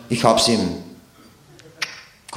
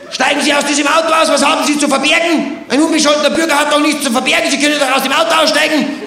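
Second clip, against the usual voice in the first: shouting policeman; 5 semitones higher than usual. Retold or imitated. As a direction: yell it at the car window. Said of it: "Dann hat er geschrien". (laut geschrien wie ein wütender Polizist)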